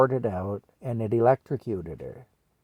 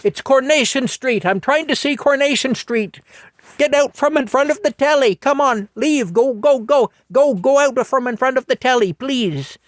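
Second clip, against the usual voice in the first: put-on high, loud older British lady shooing a child; Mattie shooing the kid; 12 semitones higher, 6 dB louder than usual. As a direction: fuss at the kid blocking her telly. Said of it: "you know, going".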